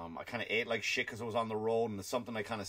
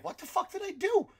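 high, loud squealing disbelief; mock panicked whine, way up high; bewildered panic; 9 semitones higher, 7 dB louder than usual. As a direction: shriek it like a baffled fired man. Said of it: "wondering, like".

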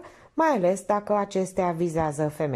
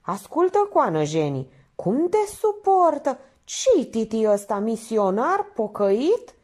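high, forceful questioning; exasperated painter; 6 semitones higher, 5 dB louder than usual. Acted. Painter asking Minerva how Titi bears her, exasperated. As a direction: fire questions with raised voice.

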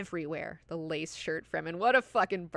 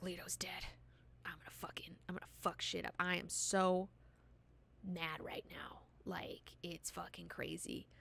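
mock angry whisper, breathy and hushed; whispered fury, no voice at all; furious whisper; 10 dB quieter than usual. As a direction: hiss out her rage under your breath. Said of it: "she's pissed".

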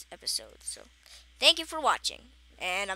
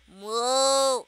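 loud cow moo; 8 dB louder than usual. Pretend. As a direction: bellow a big moo, louder. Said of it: "Like, bigger".

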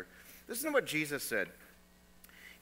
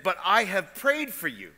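forceful quote; 4 semitones higher, 7 dB louder than usual. Acted.

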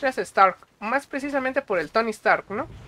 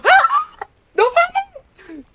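much higher, louder shriek of delight; thrilled shriek; 6 dB louder, much slower than usual. Retold or imitated. In the cinema seeing Andrew appear, an ecstatic shriek. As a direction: squeal like a fanboy seeing his hero. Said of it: "Y yo".

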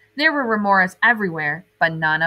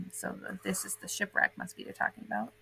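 softly whispered comment; whispered aside; 14 dB quieter than usual. Real, not acted. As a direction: lean in and whisper. Quiet aside to listener.

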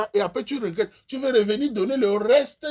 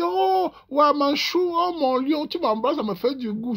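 higher cooing mock woman; swooning woman; 6 semitones higher, slower than usual. Woman swooning over a rich man, hammed-up. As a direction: coo like a charmed girlfriend.

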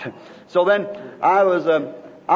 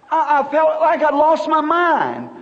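normal talking voice

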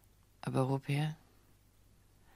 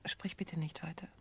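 pleading whisper; 11 dB quieter than usual. Acted. Woman stops man's proposal.

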